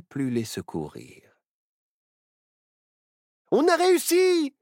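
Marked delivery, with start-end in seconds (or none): 3.5-4.5 high, loud jubilant cry; rejoicing. A narrator's reading. Nao congratulates Barnabé on the escape, rejoicing. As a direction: cheer for a victorious friend. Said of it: "se réjouit Nao".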